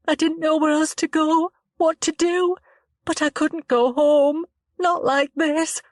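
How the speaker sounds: put-on a frightened girl telling it haltingly; shaken young woman Chloe.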